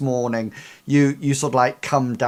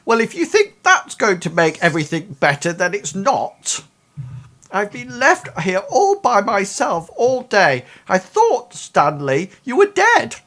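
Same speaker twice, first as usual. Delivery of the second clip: rising, louder, higher reproach from a hurt mom; aggrieved mother; 8 semitones higher, 5 dB louder than usual. Acted. Game master voicing mom scolding her son, rising to a wail. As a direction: build the guilt trip up to a high wail.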